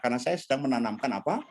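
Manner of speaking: usual speaking voice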